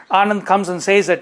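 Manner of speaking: ordinary talk, nothing marked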